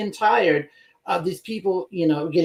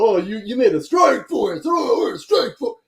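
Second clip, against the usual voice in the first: high-pitched, louder mock amazement; sardonic; 5 semitones higher, 4 dB louder than usual. Host mocking the announcement of a strike force, sardonic. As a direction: exclaim it sardonically, pitch soaring, as if hugely impressed. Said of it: "I have to say that sardonically".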